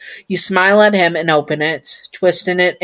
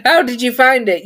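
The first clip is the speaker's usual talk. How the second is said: wide-eyed question; 6 semitones higher than usual. Acted. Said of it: "looking at him wide-eyed".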